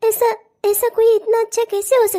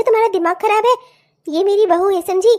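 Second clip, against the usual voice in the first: ordinary talk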